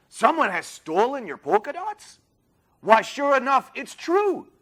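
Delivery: high, soft storybook character voice; Rudy Kazudi. A narrator's reading. Detective coming by to see the theft, high soft voice. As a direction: pitch it high and light, questioning then confirming. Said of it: "asked Rudy Kazudi".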